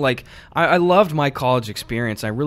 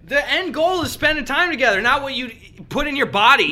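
mock voice; 12 semitones higher than usual. High mock voice of advice.

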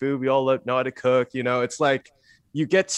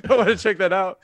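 higher pitch, amused laughter in the words; laughing; 8 semitones higher, 4 dB louder than usual. Real, not acted. Interviewee recalling wanting to try high school, laughing. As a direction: talk through a laugh. Still laughing at own old reasoning.

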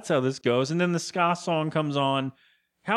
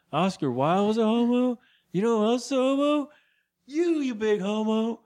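higher-pitched mock drunk proclamation; drunk character's rant; 7 semitones higher than usual. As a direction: act out a tipsy guy declaring his point. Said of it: "more fun for me to act it out".

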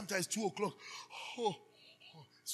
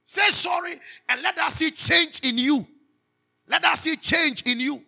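louder, higher, slow commanding shout; preaching shout; 8 semitones higher, 13 dB louder than usual. Real, not acted. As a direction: thunder it at the congregation.

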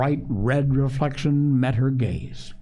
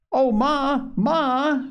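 put-on high-pitched boy's call for Ma, mildly screamed; alarmed cry; 12 semitones higher, much slower than usual. A narrator's reading. Joel calls up the stairs to his mother, alarmed cry. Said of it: "screamed Joel".

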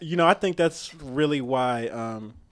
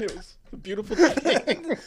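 laughing; 9 semitones higher than usual. Real, not acted. Laughing about a good role.

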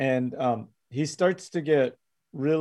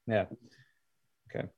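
ordinary talk, nothing marked

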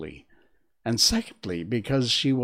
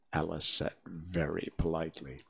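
ordinary delivery

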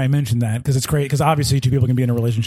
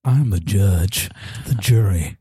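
menacing judge; 9 semitones lower than usual. Acted. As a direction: growl the threat down low. Judge threatening defendant.

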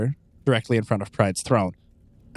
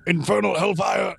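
villain shout; 9 semitones higher than usual. Acted. GM voices character, shout heard.

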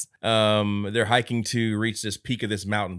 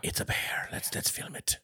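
whisper; 10 dB quieter than usual. Retold or imitated. Couple whispering about bear.

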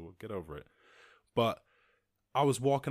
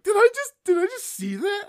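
very high, loud squeal of disbelief; shrieking disbelief; 14 dB louder than usual. Retold or imitated. Watching a stranger throw trash off a cliff, shrieking disbelief. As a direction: squeak it like your eyes can't believe it. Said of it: "I'm like".

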